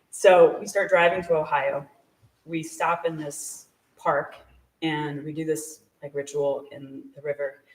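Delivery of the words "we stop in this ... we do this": ordinary delivery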